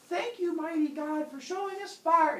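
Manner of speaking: high, worshipful chorus voice; grateful humans. Acted. Humans thank mighty god for fire, high praise.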